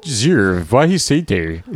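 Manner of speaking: mock Cantonese accent, swooping tones; Chinese accent. Shown as a demonstration. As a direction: put on a comic Cantonese accent. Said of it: "Can you do a Chinese accent".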